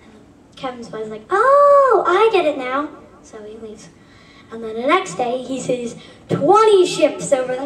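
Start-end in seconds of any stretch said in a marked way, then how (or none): 1.3-2.9 high, loud "oh" of sudden understanding; realization. Acted. Boy finally understanding the captain, high loud oh. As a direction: land the penny-drop.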